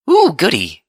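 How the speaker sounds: high, loud burst of delight; bouncy excitement. A narrator's reading. Pinkie Pie jumping at the news, bouncy excitement. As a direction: squeal it with bouncy glee. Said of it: "Pinkie Pie bounced".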